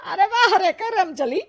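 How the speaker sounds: high, sharp taunting; mock her for bringing a sorcerer; scornful mother-in-law. Mother-in-law taunting Ritu at the door, high and scornful.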